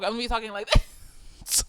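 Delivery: quick, soft, giggling through the words; laughing own voice. Shown as a demonstration. Imagining herself giving a TED talk, laughing. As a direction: rush it out, laughing at yourself. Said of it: "if I did a ted Talk".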